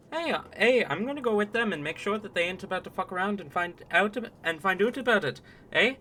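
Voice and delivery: put-on high, lively Canadian accent. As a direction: play him as a broad Canadian. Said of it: "just gonna be Canadians".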